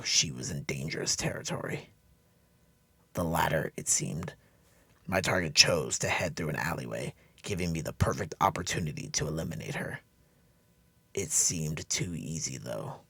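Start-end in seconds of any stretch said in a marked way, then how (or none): none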